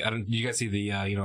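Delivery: ordinary delivery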